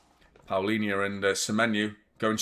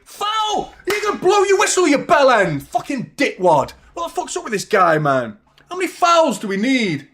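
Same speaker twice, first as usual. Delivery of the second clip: screaming very high and loud at the ref; furious shout; 13 semitones higher, 9 dB louder than usual. Real, not acted. Fan screaming at the referee for not blowing, furious. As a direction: let the ref have it.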